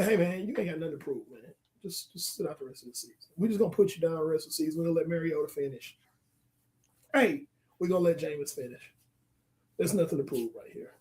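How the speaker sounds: gently sit the player down; coach calmly benching. Retold or imitated. Dan Quinn as imagined coach telling his quarterback to sit out, soft and quick. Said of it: "Dan Quinn's gonna say".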